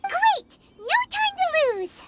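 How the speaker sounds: rally the group with excited urgency; eager. Acted. Companion rallying the group to catch the miners, eager.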